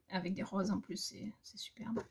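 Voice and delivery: hushed, admiring whisper. Real, not acted. Admiring rose motifs for viewers, in a hushed whisper. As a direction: breathe it out softly, charmed by the roses.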